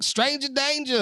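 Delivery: high, mocking kid voice; younger self. Parent mimicking their childhood self parroting the safety rule, high and mocking. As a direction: parrot the warning in a high-pitched voice. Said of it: "when I was younger, I'd be like".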